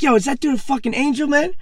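high-pitched amazed yelp of disbelief; excited high exclamation. Retold or imitated. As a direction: blurt it out in wide-eyed awe. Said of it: "I was like".

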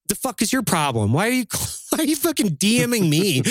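high-pitched, half-laughing incredulous rant; mock exasperation. Ranting at people who message for motivation, mock exasperated. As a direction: berate them playfully, voice riding up.

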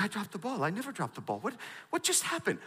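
stunned mock panic under the breath; retold panic. Center fielder to himself after the dropped catch, panicked disbelief. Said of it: "I panicked".

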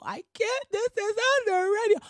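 high, whiny mock sob; hammed-up crying whine. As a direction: parody a woman wailing in tears. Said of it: "she's just so".

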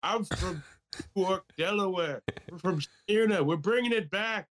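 high, put-on rallying politician; mock presidential speech. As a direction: proclaim it high like a stump speech. Mock presidential Super Bowl speech.

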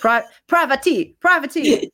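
"privacy" with a T, like privatee; strange pronunciation. Shown as a demonstration. Speaker repeating own mashup word, oddly pronounced. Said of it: "saying it in a strange accent".

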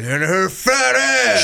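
high, drawn-out hammed-up movie outburst; exaggerated actor impression, stretching every word; Al Pacino. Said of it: "and he was like".

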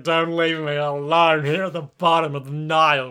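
badly faked tearful plea; mock crying. Abandoned stand at the bottom of the Nile, mock crying. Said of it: "And he's crying".

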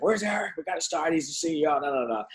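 high, hurried mock panic from the people on the call; anxious callers. Colleagues on the call impatient for the missing CEO, high mock panic. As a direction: play the jittery New York callers wondering where he is.

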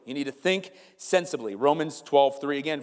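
normal talking voice

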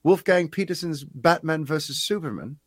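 a light imitation of a British accent; a hint of a Brit reading a question. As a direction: read the viewer's question in a mild British accent. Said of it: "I will attempt to do his accent".

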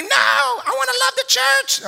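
high shouted child's protest, hammed up; mock toddler tantrum. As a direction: yell it like a kicking toddler who won't let go. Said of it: "He's kicking and screaming".

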